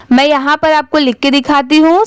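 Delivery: usual speaking voice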